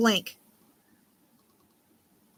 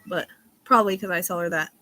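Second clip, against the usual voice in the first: usual speaking voice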